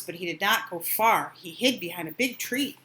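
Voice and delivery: ordinary delivery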